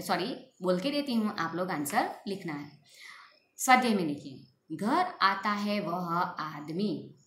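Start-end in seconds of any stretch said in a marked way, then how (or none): 4.8-7.1 very slow, word by word; slow, clear dictation of the sentence. Shown as a demonstration. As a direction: dictate the sentence slowly for writing. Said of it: "फिर से".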